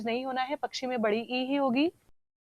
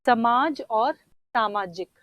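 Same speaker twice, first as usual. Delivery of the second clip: slow and deliberate; 3 dB louder, much slower than usual. Shown as a demonstration. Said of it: "उसी तरह से".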